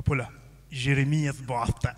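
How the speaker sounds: slow, low "Jeremias Bosta" with slushy s; low, slow, lisping "Bosta"; lisped speech. Acted. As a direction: draw out "Jeremias Bosta" with a thick tongue. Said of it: "se língua fala um bocadinho xuxa".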